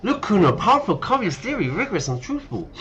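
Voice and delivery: high, swooping theatrical voice; earnest pitch to Kuno. Acted. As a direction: sell it to Kuno with big pitch swings. Reads a dialogue option in character.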